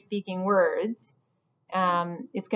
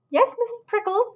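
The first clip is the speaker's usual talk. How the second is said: high, squeaky squirrel voice, reluctant; a young squirrel giving in with a sigh; 5 dB louder than usual. Acted. Squirrel answering the cook, high and reluctant.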